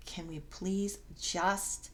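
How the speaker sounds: slow, breathy pleading whisper; frightened whisper. Acted. Janet begs Malia to leave, in a slow frightened whisper. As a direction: beg to leave in a hushed, scared whisper.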